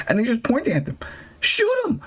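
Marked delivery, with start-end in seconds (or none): 1.4-2.0 high, sharp mock command; squeaky hammed-up command to shoot; mock order. Acted order in retold scene.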